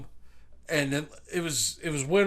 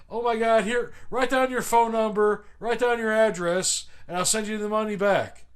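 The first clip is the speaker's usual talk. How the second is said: higher, insistent imitation of a grateful woman; mock-grateful, pitched up; retold gushing thanks; 5 semitones higher than usual. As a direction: gush your thanks and promises. Retold gushing thanks after hug.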